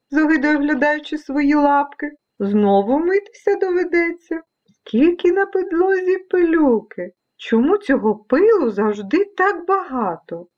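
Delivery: high voice of a disgusted cat. Acted. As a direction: complain as a fussy cat.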